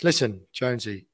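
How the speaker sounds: ordinary delivery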